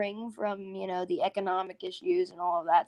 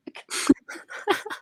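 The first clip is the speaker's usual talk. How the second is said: high breathy laugh; 12 semitones higher than usual. Real, not acted. Laughing at own story.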